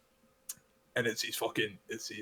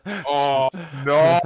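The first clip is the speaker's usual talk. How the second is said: loud, drawn-out "oh no"; retold dismay; 6 dB louder than usual. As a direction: wail it in mock horror at being found out. Acting out horrified reaction to being exposed.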